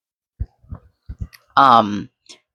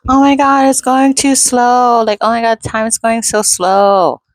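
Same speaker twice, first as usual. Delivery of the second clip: much higher, slow whiny complaint; mock complaining coworker; 9 semitones higher, 3 dB louder than usual. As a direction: whine it like a bored coworker, voice up high. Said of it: "complain a lot".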